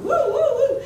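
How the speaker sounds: high, loud imitated woo-woo. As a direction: playfully mimic a child playing with his voice. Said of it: "when he started going".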